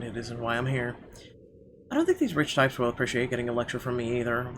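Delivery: ordinary delivery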